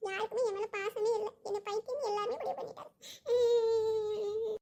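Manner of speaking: self-pitying whine ending in sobs; hammed-up weepy sulk; mock crying. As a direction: complain tearfully, then break into a wail. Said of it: "எதுக்குமா அழுதுகிட்டு இருக்க?".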